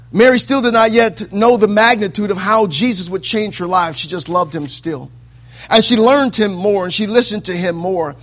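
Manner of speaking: loud, high, chanted preaching build; preaching at full force. Acted. Preacher whooping to the mothers in the congregation.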